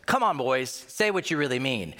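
ordinary delivery

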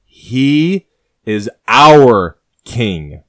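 very slow, loud, seething words; restrained rage. Acted. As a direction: force out each word in fury. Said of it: "Rage consumes Agador's body".

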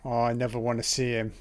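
ordinary delivery

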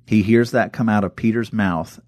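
normal talking voice

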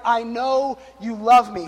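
slow, drawn-out heartfelt words; slow and reverent. Retold or imitated. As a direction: let each word land like a prayer. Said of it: "looked up to God in heaven and was like".

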